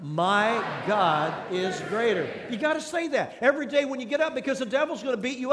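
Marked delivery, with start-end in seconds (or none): 0.0-2.3 slow, emphatic rallying declaration; forceful, word-by-word chant lead; like you mean it. Shown as a demonstration. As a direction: lead the crowd with conviction, each word drawn out. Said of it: "Let's say it like you mean it".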